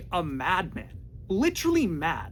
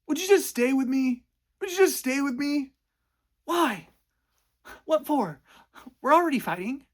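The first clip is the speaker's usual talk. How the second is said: mock pleading yell; 9 semitones higher, 4 dB louder than usual. Noah begging Allie to stay.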